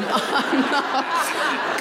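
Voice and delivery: ordinary delivery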